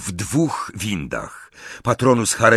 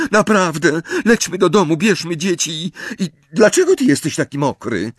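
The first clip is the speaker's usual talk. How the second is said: high, urgent woman's voice; agitated high-pitched pleading; frantic wife; 11 semitones higher than usual. Acted. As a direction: plead with your husband in a high voice. Frightened wife pleads with husband.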